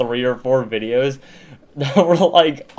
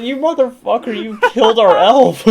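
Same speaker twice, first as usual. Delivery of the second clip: high, loud laughing mock yell at the brother; half-laughing fake fury, pitched way up; mock outrage laughing; 11 semitones higher, 8 dB louder than usual. Kid raging at brother who touched the elf, high laughing mock yell. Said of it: "I was like".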